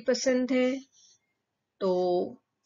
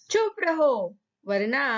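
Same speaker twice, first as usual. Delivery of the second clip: sharp, higher mock shout of a queen; shouting hush; 4 semitones higher than usual. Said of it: "चिल्ला कर कहती है". (रानी का हल्का नाटकीय डाँटना)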